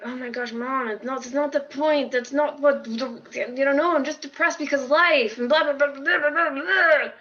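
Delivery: fed up, brushing mom off; exasperated daughter talking back to mom, voice climbing; annoyed retort. Retold or imitated. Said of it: "I'm getting all annoyed and I'm like".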